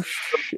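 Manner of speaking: gentle unvoiced shush; soft shush. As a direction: hush her tenderly. [name] hushing Kimmy.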